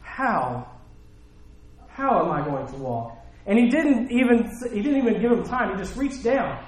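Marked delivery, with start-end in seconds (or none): 0.0-3.2 bewildered question. Acted. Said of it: "if I've never walked before, I'm gonna say".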